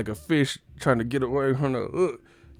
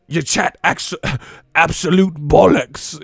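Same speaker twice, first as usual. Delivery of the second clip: put-on British accent; 4 semitones higher, 5 dB louder than usual. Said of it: "U K motherfuckers".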